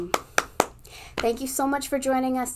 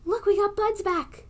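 high, gasping wonder; amazed; 11 semitones higher than usual. Acted. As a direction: sound amazed for the kids. Gasped reveal.

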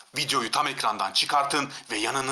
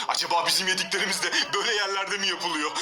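ordinary delivery

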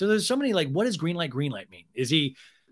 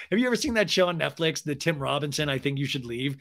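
ordinary talk

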